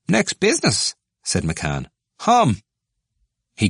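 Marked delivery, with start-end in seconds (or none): 0.1-0.9 higher, brisk announcement; a chairman moving things along; brisk call to order. Acted. McCann raps out next item. 2.2-2.6 short loud high grunt; a laughing cough of a hum; gruff hum. Acted.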